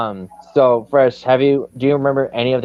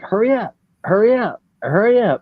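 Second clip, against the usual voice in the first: high-pitched mock urging; impatient cartoon kid; 9 semitones higher than usual. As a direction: imitate an impatient cartoon character, high, each repeat alike. Chowder urging Schnitzel, retold.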